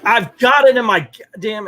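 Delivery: high, loud irritated snap with goddamn punched; angry retort. Retold or imitated. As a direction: fire it back at the worker. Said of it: "yelling at somebody".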